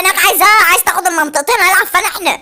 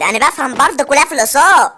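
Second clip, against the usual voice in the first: usual speaking voice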